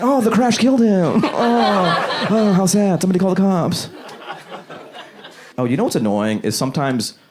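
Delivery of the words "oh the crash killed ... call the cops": 1 mock sympathy. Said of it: "And I'm like".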